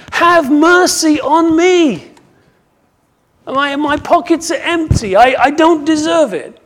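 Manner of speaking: loud, high begging cry; preacher acting a desperate appeal; impassioned plea. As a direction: plead at full voice for mercy. Acts out the beggar's plea for mercy.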